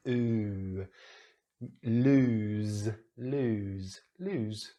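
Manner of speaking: "Lose" with a long oo and a z ending; pronunciation model, each "lose" set apart; rounded oo. Shown as a demonstration. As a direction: round the lips and hold the oo in "lose". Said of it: "need to really round the lips".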